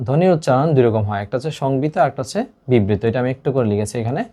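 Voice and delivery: ordinary talk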